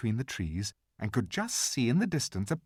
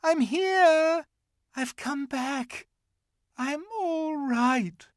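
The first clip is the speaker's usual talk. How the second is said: calling out; 4 dB louder, much slower than usual. A narrator's reading. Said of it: "she shouted".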